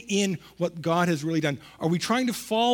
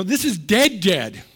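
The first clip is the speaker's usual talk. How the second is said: "dead" punched higher and much louder, then repeated; stress on dead; 3 semitones higher, 8 dB louder, slower than usual. Shown as a demonstration. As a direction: hit "dead" hard and say it twice. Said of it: "There's dead".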